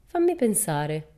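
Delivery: slow, musing, stretched out; thoughtful. Acted. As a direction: drag it out while thinking. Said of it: "corrugando la fronte meditabonda".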